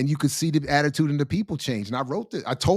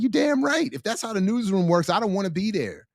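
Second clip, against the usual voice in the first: higher-pitched fired-up comeback; indignant retort; 7 semitones higher than usual. Retold or imitated. Answering people who say he's just a critic, indignant. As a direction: fire back, acting out the retort.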